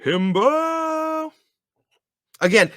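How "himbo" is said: high playful call. Acted. Playful shout-out.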